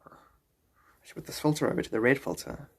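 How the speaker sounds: normal talking voice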